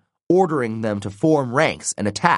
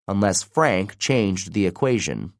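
usual speaking voice

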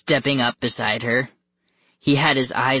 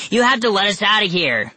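high, fast protest; rushed, raised-pitch insistence; shouting; 8 semitones higher, 4 dB louder, faster than usual. A narrator's reading. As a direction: shout a frantic demand. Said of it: "Carter shouted".

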